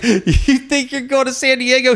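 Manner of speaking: very high, loud incredulous shout; mocking yell. Instructor ridiculing recruit's California dream, mocking yell. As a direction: imitate an instructor jeering at full volume.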